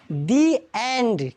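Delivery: "दी एंड" very slowly, word by word; THE as "dee". Shown as a demonstration. Teacher gives class the correct reading, slow "दी एंड". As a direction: stretch दी into a long "dee" before एंड. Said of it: "तो इसको क्या पढ़ेंगे?".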